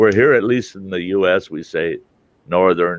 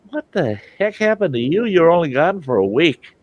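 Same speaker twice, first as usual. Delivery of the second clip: mock disbelief; 9 semitones higher than usual. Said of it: "they're talking that Texas drawl".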